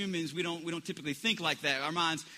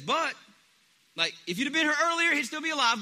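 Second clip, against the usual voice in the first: fast, higher and louder plea; Martha pleading; 8 semitones higher, 4 dB louder, faster than usual. Retold or imitated. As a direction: rush it out like a grieving sister. Martha voiced again.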